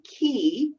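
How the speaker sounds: qi said slowly as "kee"; alternative pronunciation. Shown as a demonstration. Showing the host another pronunciation, qi said "kee". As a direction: draw out qi with a hard k. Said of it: "is one pronunciation".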